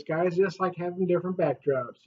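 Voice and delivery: usual speaking voice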